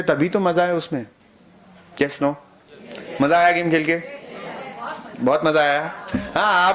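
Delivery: normal talking voice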